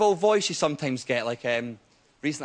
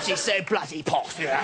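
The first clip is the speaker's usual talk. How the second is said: mock posh; 2 semitones lower than usual. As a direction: stretch it out like a royal snob. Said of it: "really posh".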